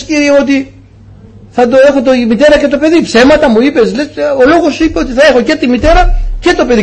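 high, pleading cry to the Lord; crying out to God. Retold or imitated. Father begging God as his newborn stops breathing, high pleading. Αρχίζω να φωνάζω στον Κύριο.

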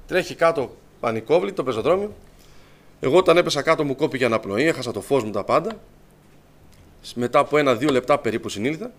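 usual speaking voice

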